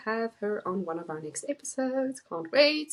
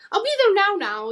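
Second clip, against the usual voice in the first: "now, now" pitched much higher and louder; urgent now; 12 semitones higher, 7 dB louder, faster than usual. Shown as a demonstration. Showing the urgent version of the phrase, high and insistent. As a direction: make "I'll be there now, now" high and pressing. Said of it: "three different types of phrases".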